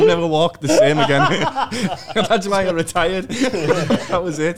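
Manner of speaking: real giggling through the words; laughing. Real, not acted. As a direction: crack up between short joke lines. Laughing through a joke punchline.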